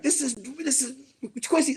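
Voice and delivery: high, sputtering mock indignation; pissed off. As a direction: stammer it out, imitating an offended man. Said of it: "he was pissed".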